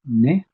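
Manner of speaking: "ne" hit high and loud; emphasized syllable. Shown as a demonstration. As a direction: punch out "ne" on its own. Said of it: "Emphasize on that".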